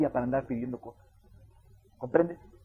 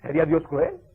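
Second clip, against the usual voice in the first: normal talking voice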